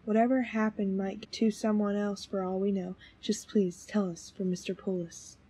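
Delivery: soft, low, soothing voice; hushed, low-pitched pleading; gentle reassurance. Acted. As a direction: quietly reassure and coax, keeping the voice down. Reassuring an upset witness.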